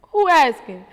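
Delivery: loud, high, suspicious who asking; sassy comeback. Retold or imitated. Mom answering her son, loud and suspicious. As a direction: snap back at the kid. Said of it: "So I'm like".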